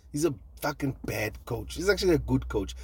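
usual speaking voice

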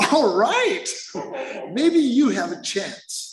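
high, slow, knowing voice; old-timer's reply. Retold or imitated. Old-timer tells the newcomer, high and slow.

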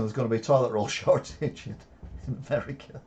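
real laugh bursting mid-sentence; laughing while talking. Real, not acted. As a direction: crack up halfway through the joke. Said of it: "shouldn't be laughing".

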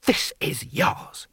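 slow, breathy hiss. A narrator's reading. Lord Hong to Rincewind, shoving his hat on, hissed. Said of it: "he hissed".

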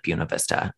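"Buena" said BYOO-nuh; locals' pronunciation. Retold or imitated. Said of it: "all of the locals called it".

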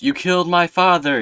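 high, loud accusing cry; hammed-up anguished shout; mock Luke. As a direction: hurl it at Vader. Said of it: "he goes".

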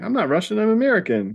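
high-pitched, hammed-up objection; mock protest. Hero corrects the gray guy, high mock protest. Retold protest at being mistaken.

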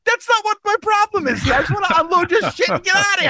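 high, fast, frustrated shout; retold yelling. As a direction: yell it over the chaos, fast and high. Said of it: "I start yelling at my passenger".

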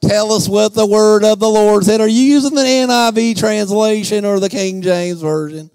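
slow, droning, drawn-out churchy voice; mock church people. Church people sitting back, mock pious drone. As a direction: parody pious pew-sitters intoning on one note. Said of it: "a lot of church people sat back".

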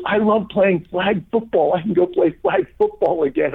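high, delighted imitation of the old man; excited old man. Man at the gas station thrilled his knee is healed, high and excited.

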